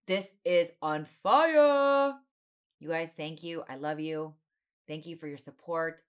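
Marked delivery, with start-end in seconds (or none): 0.1-2.1 excited. Real, not acted. Hyping up the reunion.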